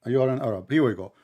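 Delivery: ordinary delivery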